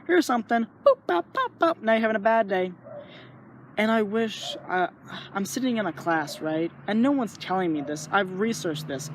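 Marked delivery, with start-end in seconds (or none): 0.1-2.7 mischievous brain voice. Retold or imitated. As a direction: tease like a prankster brain switching your mood. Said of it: "your brain decides to go".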